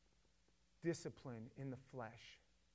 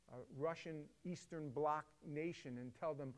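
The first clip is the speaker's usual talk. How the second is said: ordinary delivery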